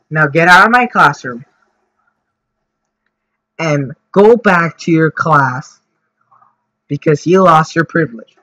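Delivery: imitating a teacher ordering a kid out; stern lecture. Teacher sends cursing kid out of class, stern. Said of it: "give the kid a lecture".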